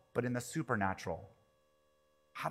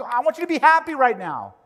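much higher and louder, forced bright cheer; mock cheery comforter; 12 semitones higher, 12 dB louder than usual. Churchgoer forcing cheer on a mourner, hammed-up chirpy. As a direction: push a brush-off happiness on a grieving friend. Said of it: "We wanna".

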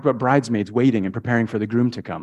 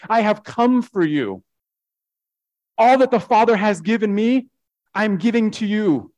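raised, insistent sermon delivery; impassioned preaching; 6 semitones higher than usual. Acted. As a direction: preach it as a heartfelt plea to the congregation.

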